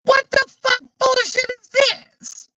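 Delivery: high-pitched disbelieving yelp; incredulous outburst. Retold or imitated. Scrolling TikTok, shocked by a bizarre video, high incredulous yelp. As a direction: squeak out the outrage in disbelief. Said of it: "And I'm just like".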